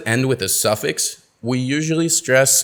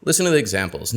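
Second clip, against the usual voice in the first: ordinary talk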